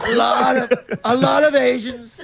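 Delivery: Trump impression. Second comic echoes the Trump line, loud. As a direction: shout it back like Trump. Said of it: "Your Trump's good".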